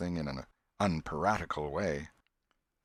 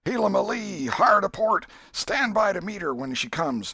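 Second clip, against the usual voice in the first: higher and much louder, building to a yell; shouted captain's orders; 13 semitones higher, 8 dB louder than usual. A narrator's reading. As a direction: roar the orders over the storm.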